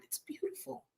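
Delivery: ordinary talk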